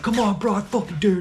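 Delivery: high, loud taunting challenge; imitating a buff uncle yelling a challenge at the van; trash-talk shout. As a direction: dare him to fight, yelling. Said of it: "he's just talking all this shit".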